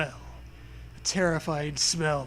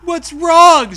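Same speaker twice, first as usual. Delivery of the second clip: much higher, loud worried cry; alarmed Zoe; 10 semitones higher, 15 dB louder than usual. Acted. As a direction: call out in alarm. Zoe's worried question.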